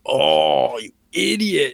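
drawn-out mock groan rising into the insult; mock exasperation, stretching it out; exasperated imitation. Bo-Katan scolding Din over the Darksaber, exasperated imitation.

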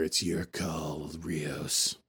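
low, slow menacing "It's your call, Rios"; a hint of Narek dropping his voice; mild mock threat; ominous ultimatum.

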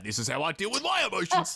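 put-on gruff dad line through a laugh; mock angry dad. Dad explaining his anger outlet, half-laughing mock voice. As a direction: play the dad proudly announcing his rage.

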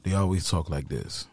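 low, quiet imitation of a toxic man; toxic man's deep voice. Mocking how toxic men always talk, in a deep voice. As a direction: drop your voice deep and soft, mimicking him. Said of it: "deep voice".

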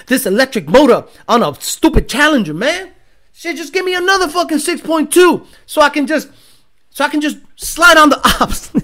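high, loud mock rant in an accent; Demonology in put-on accent. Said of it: "why I went with that accent".

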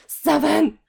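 high loud triumphant cry; excited shout. Retold or imitated. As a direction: yell it out triumphantly. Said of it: "Monica's like".

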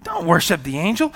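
ordinary talk, nothing marked

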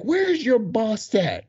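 high, drawn-out challenge; mad, retold. Dancer demanding to see the boss, high slow indignation. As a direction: demand it slowly in acted anger. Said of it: "Now I'm mad".

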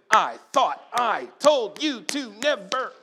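loud, slow, laughing scold; a mother scolding her son, hammed up and loud; angry mom scolding. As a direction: slap out each word like a furious mother. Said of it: "Something like this".

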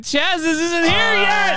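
high, drawn-out comic whine; hammed-up wail of grievance, stretched out; mock outrage. Mock outrage over the missing package.

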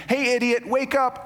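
high, jeering wake-up call; lightly voiced rude captain; mock captain scolding. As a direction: bark at the sleeper, half joking. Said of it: "I may have added that a little bit".

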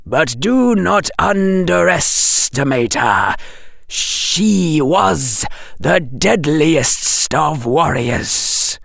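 slow, high, hissing serpent; slow serpent warning; hissing snake. A narrator's reading. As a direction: draw the words out like a snake. Snake speaking to the Dark Lord.

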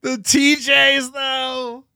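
high pitched laugh-crying; laughing so hard the voice cracks. Real, not acted. Cracking up at the joke.

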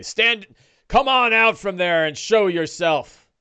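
loud, high orc command; orc challenge. Acted. Orc orders hidden intruders out, shouting. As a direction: holler it like an orc lookout.

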